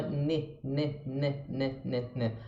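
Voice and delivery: "ne" soft and light, no nh; light English n. Shown as a demonstration. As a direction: tap a light "ne" over and over. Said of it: "No inglês é mais leve". ("ne" leve, sem nhê)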